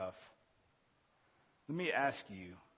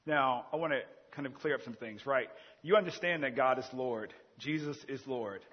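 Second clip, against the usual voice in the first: ordinary talk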